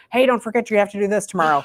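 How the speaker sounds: pushy, quick-fire mental reminder; nagging inner voice. Acted. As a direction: rattle it off like a pestering thought. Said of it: "that talking that shows up in your head".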